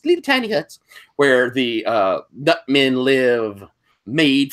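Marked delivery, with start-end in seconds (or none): 0.0-0.8 squeaky high little voice; tiny high voice. Acted. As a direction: say it small and cute. Repeats it in a tiny voice.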